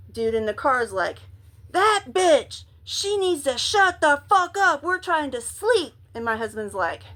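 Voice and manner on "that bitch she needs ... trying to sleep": imitated irate shout, pitched up; high, forceful mock rant; angry stranger yelling. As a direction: complain loudly as the fed-up man in the car. Angry man in car, retold.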